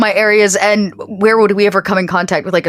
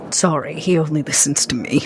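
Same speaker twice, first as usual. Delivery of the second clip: unvoiced whispered aside; sly whispered aside; 4 semitones lower, 5 dB quieter than usual. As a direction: breathe it out like a secret. Aside about Lyle, whispered.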